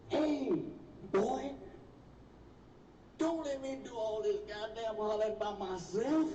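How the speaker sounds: half-hearted retold holler to her boy; mock hollering. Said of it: "she started hollering like hell".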